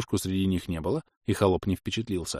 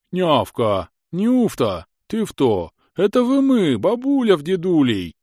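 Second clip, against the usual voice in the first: higher, louder calling out; distant call; 9 semitones higher, 6 dB louder, slower than usual. A narrator's reading. Scene: villagers call out to their granddaughter, loudly. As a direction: shout across the clearing.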